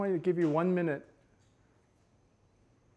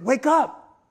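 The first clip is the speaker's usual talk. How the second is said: loud high wake-up call; rousing a sleeping teenager loudly; 9 semitones higher, 13 dB louder than usual. Retold or imitated. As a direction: shout to rouse him. Calling out to sleeping kid.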